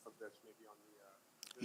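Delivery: fading out, muffled voice; speaking muffled behind a mask; muffled through a mask. Real, not acted. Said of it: "fading out".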